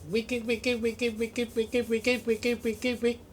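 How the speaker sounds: high, soft repeated wicked like a scratched record; turntable scratch. Pretend. Vlogger scratching a record, high soft looping wicked. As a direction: stutter the word like a DJ scratch.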